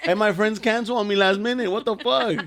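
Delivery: high-pitched, laughing disbelief; indignant laughing rant. Real, not acted. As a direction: vent while cracking up.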